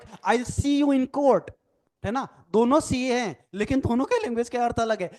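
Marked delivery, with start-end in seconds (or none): 0.0-1.5 slow, menacing, higher-pitched threat; threatening. Shown as a demonstration. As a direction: drag out the words like a warning. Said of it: "धमकी आ गई ना". (धमकी भरे अंदाज़ में)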